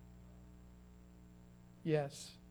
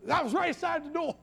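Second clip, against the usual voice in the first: startled exclamation; 9 semitones higher than usual. Acted. Acting out the startled remark.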